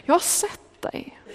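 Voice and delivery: high, hushed whisper of comfort; tender whisper. Acted. Jesus whispering comfort to the doubting Natanael. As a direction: breathe the words out, gently and slowly.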